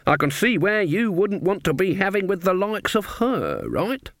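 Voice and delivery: put-on high, sweetly innocent old woman; sweet old lady. A narrator's reading. Granny fakes innocence to the woodcutter, sweet and high.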